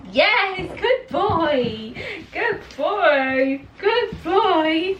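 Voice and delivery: high, sing-song praise for the dog. Real, not acted. Owner praising her puppy, high sing-song.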